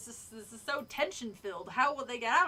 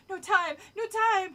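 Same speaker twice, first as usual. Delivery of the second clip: urgent declaration; 7 semitones higher, 7 dB louder than usual. A narrator's reading. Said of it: "I declared".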